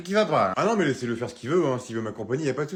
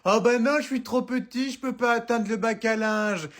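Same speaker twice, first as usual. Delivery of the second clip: mother imitating her son in a higher childish whine; mocking little kid; 7 semitones higher than usual. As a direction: mimic the kid's excuse, higher and babyish. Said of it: "tu me dis". (mère qui imite son fils, petite voix d'enfant moqueuse)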